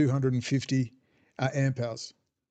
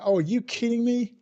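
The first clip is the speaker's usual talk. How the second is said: mock disbelief; 8 semitones higher than usual. Acted incredulous reaction to dumbasses.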